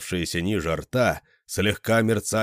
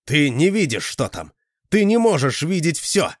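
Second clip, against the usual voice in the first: defiant shout; 7 semitones higher, 4 dB louder than usual. A narrator's reading. Boy defies monster.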